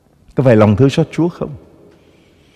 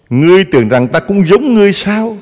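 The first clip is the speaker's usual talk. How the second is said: high, loud rebuke as God; God rebuking; 9 semitones higher, 4 dB louder than usual. Acted. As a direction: thunder the question down as God. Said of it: "Chúa nói".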